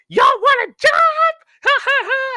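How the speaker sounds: over-the-top commercial showman; wild ad pitchman. Retold or imitated. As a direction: screech the offer, then a fake laugh. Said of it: "like he's Super Freak or someone".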